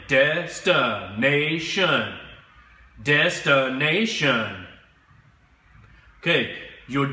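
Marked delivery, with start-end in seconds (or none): 0.1-4.7 destination drawn out slowly, every syllable clear; slow model word. Shown as a demonstration. Teacher repeats the new word slowly for students. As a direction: stretch out destination for the class to echo. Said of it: "Say it again".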